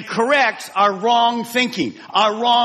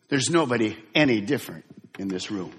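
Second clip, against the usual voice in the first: ordinary talk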